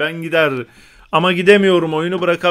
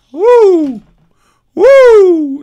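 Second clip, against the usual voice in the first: loud, much higher woo whoops; whoop of excitement; 12 semitones higher, 9 dB louder than usual. Real, not acted. Gamer whooping loudly at a great play, thrilled. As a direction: let out two high loud whoops.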